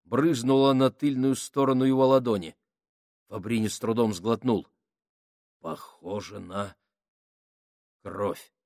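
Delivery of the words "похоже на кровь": low, slow and hushed; hushed dread. A narrator's reading. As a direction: say it slowly under your breath. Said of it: "сказал Кук".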